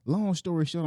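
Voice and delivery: ordinary delivery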